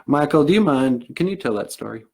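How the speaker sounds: lightly voiced Southern character; gentlemanly Southern accent, asking a favor; Anamosh. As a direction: hand it over in a mild Southern accent. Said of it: "he's got a Southern accent".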